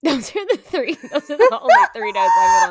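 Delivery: high, loud laughing confession; laughing embarrassed. Real, not acted. Admitting to her cohost her tiny notes, laughing. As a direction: laugh through the guilty admission.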